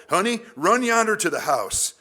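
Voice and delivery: high, loud Southern call; Southern accent. Acted. Father sending a little boy to the house, Southern accent. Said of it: "I had to go Southern accent with that".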